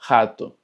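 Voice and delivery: Rato said "HAH-too", a throaty h start and final oo; Portuguese R. Shown as a demonstration. Teacher modelling a Portuguese initial R, throaty h. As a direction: Rato with a breathy h for the R. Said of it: "ratón en portugués se dice rato".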